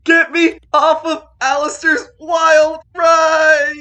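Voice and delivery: slow, high, loud yelling plea; desperate shout. Acted. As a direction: scream it out while being flung around. NPC shouting from the portal loop.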